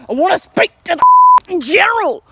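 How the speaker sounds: bully customer insisting loudly; loud tough guy demand. Retold or imitated. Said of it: "and he's like".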